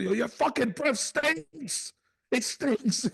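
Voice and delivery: very high, drawn-out mock yell; retold loud outburst. Aunt to the terrified lady beside her, mock outburst. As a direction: imitate an aunt wailing her disgust. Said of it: "it was so loud".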